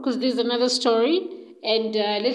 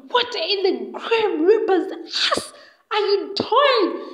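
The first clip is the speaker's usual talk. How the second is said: screaming insult; 8 semitones higher than usual. Retold or imitated. Harriet catching the two together, screaming insults. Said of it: "screaming and insulting them".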